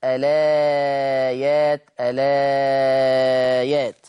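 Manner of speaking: الآيات with the long vowel stretched out slowly; Warsh reading of الآيات. Shown as a demonstration. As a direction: elongate the madd in الآيات. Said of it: "يقرأ ورش الآيات". (الآيات بمد البدل مشبع)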